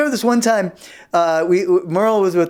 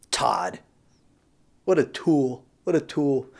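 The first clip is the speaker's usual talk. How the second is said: much lower, soft contempt; disgusted aside; 5 semitones lower, 5 dB quieter, slower than usual. Acted. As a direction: sigh it with disdain.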